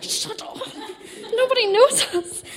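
high-pitched, hammed-up shushing of a friend; retold flustered protest. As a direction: act out squeakily silencing an excited friend. Retold hushing of excited friend.

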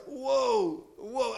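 high, awed double whoa; amazed. Acted. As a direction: act out being taken aback. Acting out amazement at the ending.

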